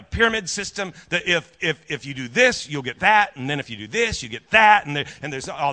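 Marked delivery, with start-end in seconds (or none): none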